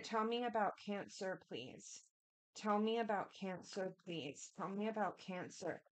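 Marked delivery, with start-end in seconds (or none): 1.5-2.0 soft please. Real, not acted. Asking spirit during card pull. 4.0-4.5 soft please. Real, not acted. Asking spirit during card pull.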